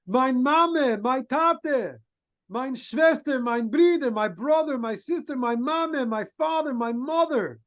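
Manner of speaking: lightly voiced boy pleading, high and urgent; pleading scream. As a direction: beg the king to save your family. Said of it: "he screams out".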